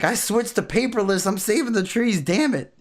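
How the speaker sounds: high-pitched imitation of an indignant friend; mock indignant protest.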